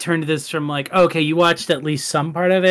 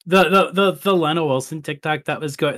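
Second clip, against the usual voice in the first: ordinary talk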